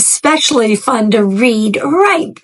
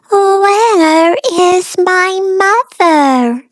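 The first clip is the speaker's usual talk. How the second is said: high, slow little bird asking; lost chick calling out, small and high; baby bird voice; 8 semitones higher, 4 dB louder, slower than usual. A narrator's reading. Baby bird asks for his missing mother, high and slow. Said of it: "he said".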